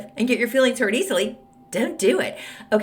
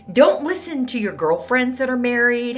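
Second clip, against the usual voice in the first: ordinary talk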